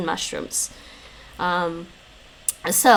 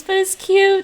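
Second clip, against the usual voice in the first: usual speaking voice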